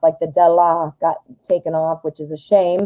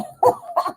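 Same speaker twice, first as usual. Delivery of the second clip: laughing at herself; 4 dB quieter than usual. Real, not acted. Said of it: "I crack myself up".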